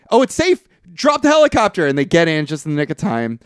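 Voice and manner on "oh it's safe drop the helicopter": high, loud fake-cheerful call; mock reassuring lie. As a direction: sell the lie, pitched up. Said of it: "and they say".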